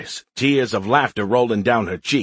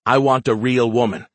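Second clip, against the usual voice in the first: ordinary delivery, nothing marked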